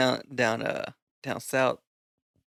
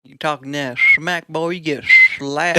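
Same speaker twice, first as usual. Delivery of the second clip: put-on cowboy accent; 8 dB louder than usual. Warning a smack talker, loud cowboy accent. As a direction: threaten in a hammed-up cowboy accent. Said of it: "a cowboy accent".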